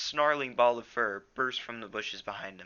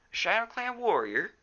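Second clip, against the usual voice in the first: high, loud astonished question; wide-eyed echo; 13 semitones higher, 6 dB louder than usual. A narrator's reading. Graypaw hearing Firepaw saw an enemy, surprised. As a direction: echo it back in disbelief. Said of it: "echoed Graypaw, his eyes wide".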